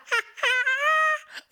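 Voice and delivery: sudden high laughing burst; delighted laughter bursting out high; big burst of laughter. Real, not acted.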